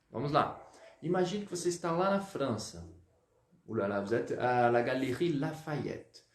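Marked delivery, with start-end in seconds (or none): 3.7-6.2 breezy Parisian shopper voice; mock French flair; playful French voice. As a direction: act out a French shop visit, lightly. Teacher acts French shop scene.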